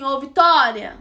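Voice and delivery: loud retold call of a name; imitated shout. Guy calling to her from his car, imitated shout. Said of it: "Aí ele gritou assim".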